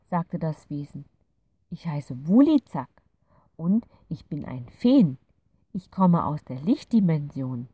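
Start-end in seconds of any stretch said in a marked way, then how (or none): 1.7-7.7 louder, lightly voiced fairy introducing itself; a kind little creature saying hello; friendly fairy. A narrator's reading. Fairy character voice. (freundliche kleine Fee, leicht gespielt)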